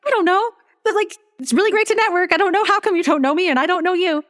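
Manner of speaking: put-on high voice, held throughout; high-pitched reply. Retelling a reply to a new networking contact, high-pitched. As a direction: lift the whole retold reply way up high.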